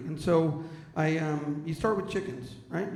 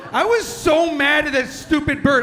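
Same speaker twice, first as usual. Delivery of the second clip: high, loud laughing rant; mock furious shout; 9 semitones higher, 12 dB louder than usual. Real, not acted. Comedian telling the crowd about the bird, laughing outraged shout. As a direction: yell it, half laughing in outrage.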